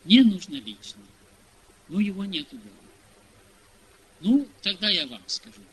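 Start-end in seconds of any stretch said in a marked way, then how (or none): none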